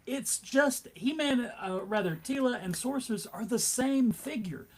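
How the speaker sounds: ordinary talk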